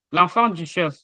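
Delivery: ordinary delivery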